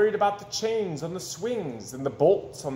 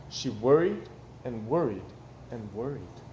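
usual speaking voice

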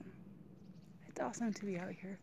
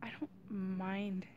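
getting emotional. Real, not acted. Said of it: "I'm getting emotional".

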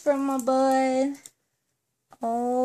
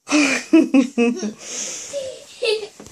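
warm laugh; 3 semitones higher than usual. Real, not acted. Mother laughing at child.

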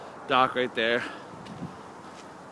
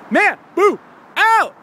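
shouted catchphrase; 14 semitones higher, 7 dB louder, much slower than usual. Acted. Vlog catchphrase sign-off.

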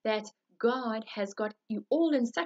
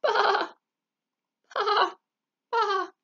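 sheep bleating; 10 semitones higher, 6 dB louder, much slower than usual. Acted.

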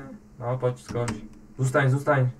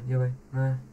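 ordinary delivery

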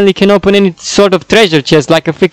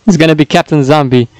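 normal talking voice

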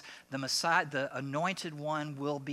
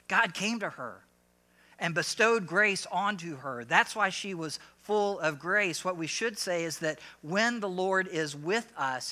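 louder, ringing, emphatic pulpit voice; full-force preaching; 3 semitones higher, 6 dB louder than usual. Acted. Pastor correcting a belief before the congregation, preaching loudly. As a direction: drive the point home to the pews.

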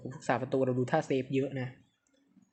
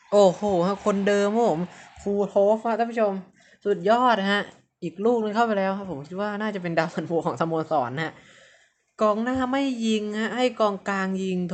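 เสียงสูงดังแบบนักพากย์ฟุตบอลตอนได้ประตู; ตะโกนเชียร์ลูกยิงอย่างสุดเหวี่ยง; พากย์ตื่นเต้น; 6 semitones higher, 6 dB louder than usual. Real, not acted. (เสียงพากย์บอลตื่นเต้นตอนทีมยิงประตู)

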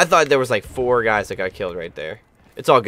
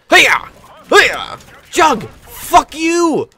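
high hype yells after a kill; triumphant yelling; 7 dB louder, much slower than usual. Real, not acted. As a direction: whoop it up, much higher.

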